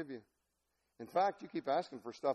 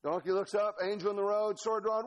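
normal talking voice

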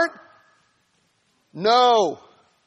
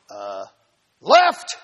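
donkey's warning; 13 semitones lower than usual. Acted. Donkey voiced in story.

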